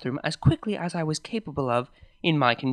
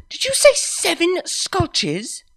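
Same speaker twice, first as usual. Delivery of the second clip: incredulous; 8 dB louder, slower than usual. Acted. Shocked at hearing seven scotches.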